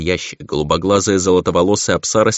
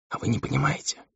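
put-on a soft, shy half-whisper of a young girl; 8 semitones higher, 10 dB quieter than usual. A narrator's reading. Застенчивый шёпот. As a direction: she shyly asks the demon if he doesn't understand, hushed. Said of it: "прошептала".

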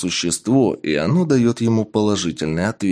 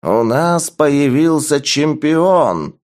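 slow, booming call to the arena; booming proclamation; 6 semitones higher, 4 dB louder, slower than usual. Acted. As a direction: proclaim to the whole crowd. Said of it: "Голос архижреца ... разнёсся по арене".

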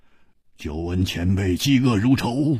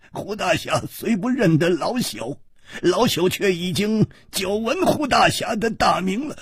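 high-pitched, chuckling elder; smiling old man; 9 semitones higher than usual. A narrator's reading. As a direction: greet him as an amused old-timer, voice up high. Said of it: "那个老头笑着说".

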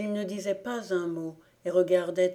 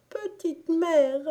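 put-on high, loud child's cry for mother; 10 semitones higher, 5 dB louder, slower than usual. A narrator's reading. Beaten boy crying out for his mother, high and loud. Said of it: "il criait".

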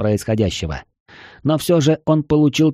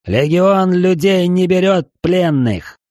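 slow theatrical villain menace; slow, drawn-out evil proclamation; 6 semitones higher, 3 dB louder, slower than usual. Acted. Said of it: "злодейским голосом воскликнул".

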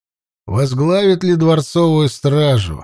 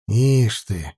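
snorting; 4 semitones lower than usual. Acted. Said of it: "хмыкнул Учай".

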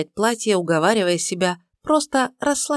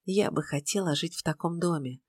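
whispering; 8 dB quieter than usual. A narrator's reading. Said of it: "прошептала демону".

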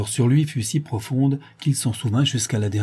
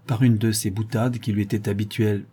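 ordinary delivery

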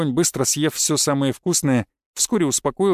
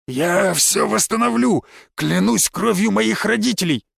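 high, pleading oath; desperate vow; 6 semitones higher than usual. A narrator's reading. Kneeling arsonist swears to rebuild the barn, desperate.

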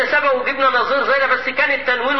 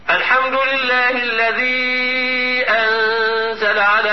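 slow chanted recitation, pausing and breathing at عوجا; recitation with a breath stop; slower than usual. Shown as a demonstration. Teacher demonstrating a wrong stop with a breath to students. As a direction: chant it slowly, stop and breathe after عوجا before قيما. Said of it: "اسمع معايا وقول لي الفرق".

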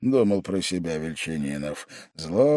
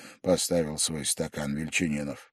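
plain reading voice

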